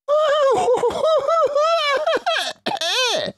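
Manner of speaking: very high, loud giddy laugh; gasping laugh. Acted. Smallest fox laughs after the fiery cider. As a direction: burst out laughing breathlessly. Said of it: "gasped the smallest fox".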